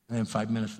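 ordinary talk

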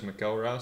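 McElrath said as muh-KELL-rath; alternative pronunciation. Shown as a demonstration. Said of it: "some people know me as".

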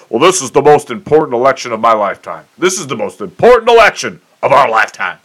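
mild mock politician pitch; mock campaign cliché. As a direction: parrot the stump-speech line. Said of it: "you hear it every four years".

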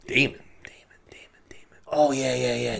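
whispering. Retold or imitated. Forgetful king whispering name.

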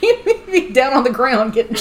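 high, loud, through real laughter; laughing. Real, not acted. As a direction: crack up while talking. Cracking up at the joke.

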